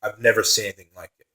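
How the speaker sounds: normal talking voice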